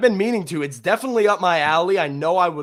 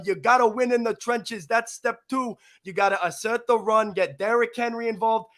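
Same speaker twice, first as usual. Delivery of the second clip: higher-pitched, hammed-up Italian-American New Jersey mobster accent; Tony Soprano; 4 semitones higher than usual. Show guest gives football keys on a dare, hammed-up Sopranos accent. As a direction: play a punchy mobster pundit with a thick Italian-American accent. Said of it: "your first word in a Sopranos accent".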